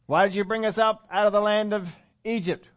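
high-pitched put-on protest; mock complaining. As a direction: challenge the leaders as an aggrieved grumbler. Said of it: "they begin to complain against Moses".

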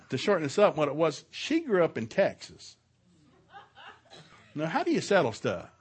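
ordinary talk

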